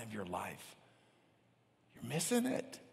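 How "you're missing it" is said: very high, soft, rising; soft high plea that rises at the end; high-pitched warning. Real, not acted. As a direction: lift it right up in a hushed voice. First of two contrasting takes.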